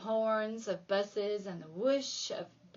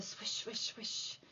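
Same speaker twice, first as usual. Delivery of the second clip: much softer, breathy swish swish; 11 dB quieter than usual. Acted. Narrator mimics the wind, hushed. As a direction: let the wind sound drift away.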